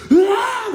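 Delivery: high-pitched mock yelp of fright; sudden loud, comic scream; startled scream. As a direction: shriek like a big kid spooked by a bug. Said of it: "하면서 놀래요".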